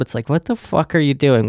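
ordinary talk

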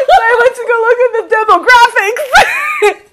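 loud laughing through words. Real, not acted. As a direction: laugh while you tell it.

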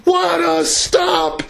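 shouted goal call, voice cracking. Real, not acted. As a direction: voice cracking on a high excited call. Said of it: "I'm sorry about the voice cracks".

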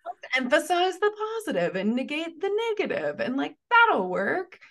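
high, loud and fast sarcastic sing-song; exaggerated mock-cheerful parody. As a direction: send up the naive plan with glee. Mocking positivity fix.

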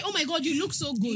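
high-pitched imitated gush; gushing compliment. Voicing what he was about to say, gushing.

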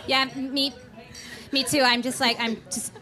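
ordinary delivery

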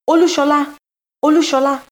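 high, loud calling out a name; desperate shout across the water. A narrator's reading. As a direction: call for the lost son with everything. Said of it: "I called desperately".

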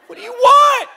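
rising to a high loud exasperated cry; frustrated husband shouting at his wife; exasperated yell. Retold or imitated. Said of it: "I'll be like".

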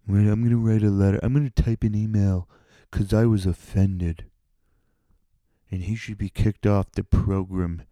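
whiny mock voice of an offended listener; mocking offended nerd. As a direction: sneer at the complainers by playing one. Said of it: "like some of you fucking nerds".

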